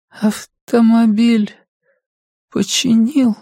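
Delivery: high, very slow, weak mother; labored speech. A narrator's reading. Sick mother asks son about travel, speaking with effort. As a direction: force out each word with long pauses. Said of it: "Каждое слово давалось ей с трудом".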